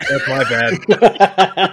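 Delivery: slow, laughing apology. Real, not acted. Laughing at own mistake.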